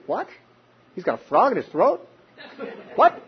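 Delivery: puzzled, questioning listeners, lightly voiced; a hint of baffled audience asking; confused.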